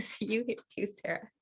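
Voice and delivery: ordinary talk, nothing marked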